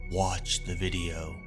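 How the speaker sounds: plain reading voice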